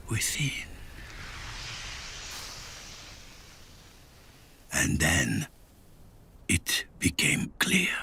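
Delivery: hushed, halting reflection with long pauses; inner thought. A narrator's reading. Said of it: "he thought".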